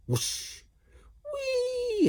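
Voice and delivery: very high gleeful whee. A narrator's reading. Ned sliding on his bottom, high squeal. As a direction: squeal of fun. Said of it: "he exclaimed".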